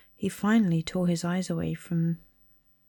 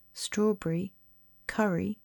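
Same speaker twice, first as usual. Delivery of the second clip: plain reading voice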